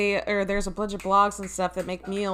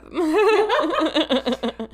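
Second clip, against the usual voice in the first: high bursting laugh; loud laugh; 9 semitones higher, 5 dB louder than usual. Real, not acted. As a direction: let out a big laugh. Laughing at own drinking.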